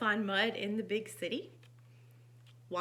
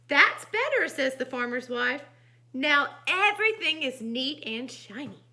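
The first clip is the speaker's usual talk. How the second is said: animated reading with raised character lines; expressive storytime voice; story narration; 3 semitones higher, 7 dB louder than usual. A narrator's reading. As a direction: read to children, lifting the dialogue. Reads picture book aloud.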